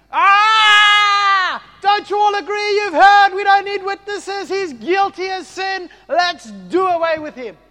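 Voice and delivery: high, loud furious scream; screaming high priest. Acted. Caiaphas urging the council to condemn Jesus, screaming. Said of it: "he screams and he says".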